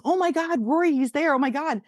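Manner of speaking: high-pitched, amazed re-enactment; flipping out. Retold or imitated. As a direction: relive freaking out at the discovery. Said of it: "like, flipping".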